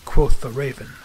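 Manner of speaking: usual speaking voice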